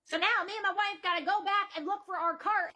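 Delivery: usual speaking voice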